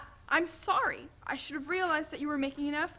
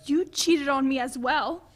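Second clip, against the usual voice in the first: defensive retort; 9 dB louder than usual. Acted. Spouses quarrel over cheating.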